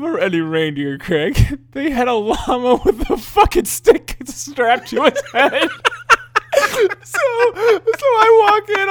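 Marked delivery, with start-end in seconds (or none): 1.7-8.9 high-pitched laughter through the words; laughing hard. Real, not acted. Telling about the llama reindeer, laughing helplessly. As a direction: barely get it out for laughing. Cracking up mid story.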